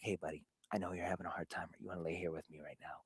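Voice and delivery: fast, soft whispered comfort; gentle whisper to son. Retold or imitated. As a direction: whisper it softly to calm a crying child. Soothing upset son, whispering.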